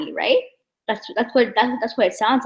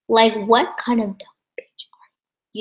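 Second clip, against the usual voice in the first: hissed aside, no voice; scornful whisper; 2 semitones higher than usual. Real, not acted. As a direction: whisper the insult under your breath. Scornful aside about the snitch.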